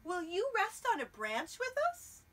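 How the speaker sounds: high, loud cardinal inviting squirrel; bird voice. Acted. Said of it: "said the cardinal".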